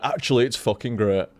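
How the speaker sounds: normal talking voice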